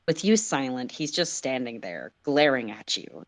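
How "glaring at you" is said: usual speaking voice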